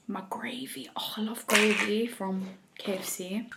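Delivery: soft whisper, no voice. Real, not acted. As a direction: breathe it as a hushed whisper. Whispering over her food.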